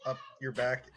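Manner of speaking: ordinary talk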